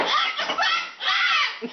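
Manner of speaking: high delighted laugh; high burst of laughter. Real, not acted. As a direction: crack up at the bird.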